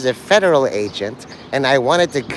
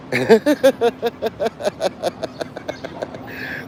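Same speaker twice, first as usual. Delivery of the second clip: high soft giggle; 8 semitones higher, 4 dB quieter than usual. Real, not acted. Laughs after his own joke.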